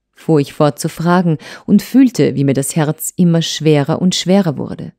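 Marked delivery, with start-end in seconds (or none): none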